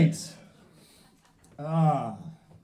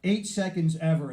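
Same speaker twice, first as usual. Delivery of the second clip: normal talking voice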